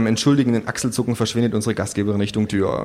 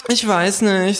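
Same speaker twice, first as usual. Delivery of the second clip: put-on a lightly higher young man; 10 semitones higher, slower than usual. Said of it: "sagte ein junger Mann". (leicht höher gesprochener junger Mann)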